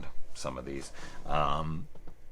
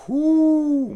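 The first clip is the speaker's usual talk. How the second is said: loud whoop; 12 dB louder than usual. Real, not acted. Reacting to the creepy mom.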